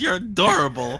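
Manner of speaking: laughing, slow and fond. Real, not acted. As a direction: gush through laughter. Laughing at cute rat.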